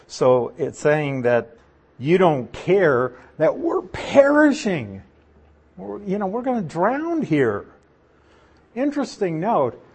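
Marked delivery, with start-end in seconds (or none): 2.0-5.0 slow, weighty stress on "perishing"; drawn-out protest landing on "perishing"; emphatic complaint. Retold or imitated. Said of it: "one is to add emphasis". 5.8-7.6 high-pitched imitated protest; lightly acted high plea; mock alarm. Acting out the drowning disciples' protest.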